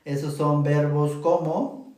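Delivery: ordinary talk, nothing marked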